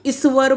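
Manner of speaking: ईश्वर said as "iswar", with s for sh; common mispronunciation. Shown as a demonstration. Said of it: "बोल देते हैं लोग".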